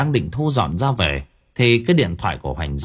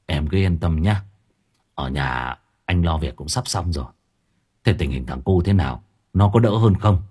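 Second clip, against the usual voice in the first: calm her down gently; soothing husband; 6 semitones lower than usual. Acted. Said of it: "nói liền một hơi". (dỗ dành vợ nhẹ nhàng)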